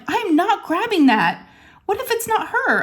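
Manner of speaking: high-pitched retold protest; alarmed refusal. Mother refusing to grab the rodent, alarmed.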